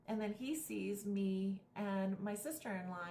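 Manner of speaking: ordinary delivery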